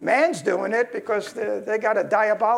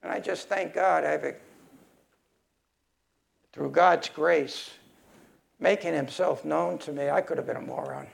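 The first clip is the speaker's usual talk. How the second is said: humble gratitude; 7 semitones lower, 4 dB quieter than usual. Real, not acted. Humble thanks after angry rant.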